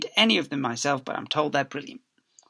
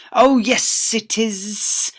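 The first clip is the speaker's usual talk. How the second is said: higher, louder insistence; mock stern retort; 8 semitones higher, 9 dB louder, much slower than usual. Miss Spick contradicting the girl, smug and stern. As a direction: insist smugly.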